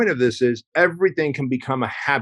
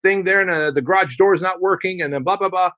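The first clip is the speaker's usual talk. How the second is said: higher, whiny, defensive rush of excuses; mock the guy who justifies everything; pitched-up imitation of someone getting defensive; 7 semitones higher than usual. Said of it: "we typically go into defending it".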